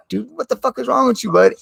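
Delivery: what is wrong with you, thrown high and scornful; exasperated outburst at the voices. Real, not acted. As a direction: pitch jumps way up, incredulous and fed up. Yelling at the voices in his head.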